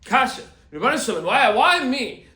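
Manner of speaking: a hammed-up why me to the sky; mock outraged cry. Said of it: "you ask a kasha".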